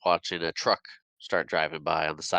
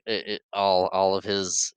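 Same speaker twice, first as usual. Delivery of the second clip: ordinary talk, nothing marked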